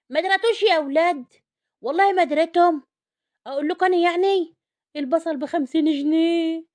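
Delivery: loud, high-pitched lament over prices; mock-outraged outcry. Housewife tells viewers the shocking onion prices, loud mock outrage. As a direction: wail it out loudly like shocking news.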